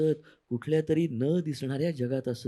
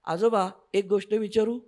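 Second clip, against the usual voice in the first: put-on higher, eager child's question; child asking; 9 semitones higher, 4 dB louder than usual. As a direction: ask like a small girl. Child asks grandpa.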